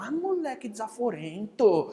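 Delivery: usual speaking voice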